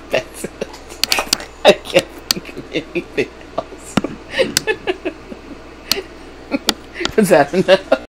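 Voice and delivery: breaking up, voice lost in giggles; helplessly tickled; through real laughter. Real, not acted. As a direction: barely get it out for laughing. Laughing after own noises.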